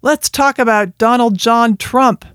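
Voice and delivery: a touch of pompous podium voice; mock podium speaker. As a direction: mimic a politician kicking off a speech. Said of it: "standing at a lectern and saying".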